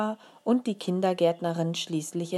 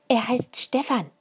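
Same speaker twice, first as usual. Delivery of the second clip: put-on proud little girl; 7 semitones higher, 5 dB louder than usual. A narrator's reading. Child tells mother beetle's name.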